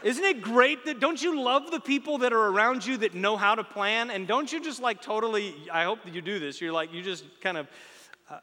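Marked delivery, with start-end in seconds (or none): 0.0-5.5 excited preaching. Real, not acted. Enthusing at the crowd.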